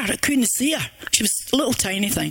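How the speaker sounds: usual speaking voice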